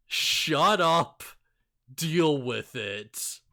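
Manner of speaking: loud put-down, slowing at the end; loud, emphatic play-acted dismissal; mock stern rebuke. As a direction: shut him down hard, drawing out the last words. Acted council scolding Anakin.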